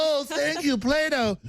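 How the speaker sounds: high, babyish mock voice; mocking toddler parody; mock childish thanks. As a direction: thank them like a pleased toddler. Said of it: "And they go".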